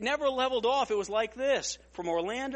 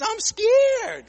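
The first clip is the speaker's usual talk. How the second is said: high loud panicky squeal with a laugh; laughing, higher and louder imitation of the calm man losing it; mock scared PhD; 12 semitones higher, 10 dB louder than usual. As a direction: turn the calm expert into a frightened wreck. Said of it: "And then he says".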